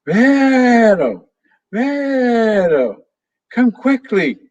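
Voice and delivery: put-on high, loud parrot calling out a girl's name; parrot mimicking a girl's shout. A narrator's reading. Parrot Pepe tricks Vero by calling in Sophia's voice, high loud shout. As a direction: squawk it like a parrot faking the girl's call. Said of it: "Sounding like Sophia, he shouted".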